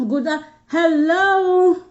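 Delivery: high, very slow, drawn-out hello; sing-song greeting. Real, not acted. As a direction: stretch the greeting like calling across a room. Greeting a viewer on live.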